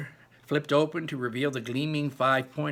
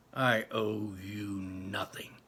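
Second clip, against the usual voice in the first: slow, breathless whispered fury; seething whisper; 2 semitones lower, slower than usual. A narrator's reading. Chase to Bear, refusing any debt, seething whisper. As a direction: spit it out in a slow hiss of rage. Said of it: "I seethe".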